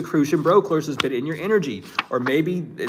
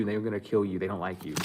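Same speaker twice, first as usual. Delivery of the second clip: strange low voice; 4 semitones lower, 6 dB quieter, faster than usual. Real, not acted. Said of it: "I don't speak like that".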